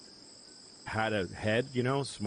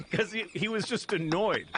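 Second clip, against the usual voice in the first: laughing; 12 semitones higher than usual. Real, not acted. Laughing while retelling.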